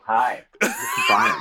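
high, slow laughing greeting. Real, not acted. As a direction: laugh through the hello. Retake after choking, laughing.

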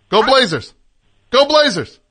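shouting through speakerphone at dogs; loud excited calls to the dog. Real, not acted. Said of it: "let's see if we can get these dogs barking".

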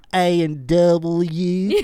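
put-on very slow, drawn-out Southern accent. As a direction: stretch it like a Southern drawl.

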